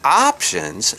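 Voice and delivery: high, drawn-out disbelieving question; incredulous echo. Retold or imitated. Storyteller reacting to mom's parenting, high incredulous echo. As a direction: repeat it back high in mock disbelief. Mocks the mother's word.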